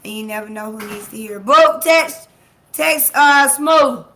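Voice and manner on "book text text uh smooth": higher, louder excited burst. Real, not acted. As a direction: fire the words out with glee. Excited mimed texting.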